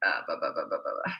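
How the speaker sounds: deep, muttered blah blah blah; mock blah blah. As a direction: drone it low like filler chatter. Mocking her own rambling.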